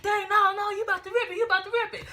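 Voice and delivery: high, loud mock alarm; panicked protest. Thief pleading as she pulls the check, mock panic.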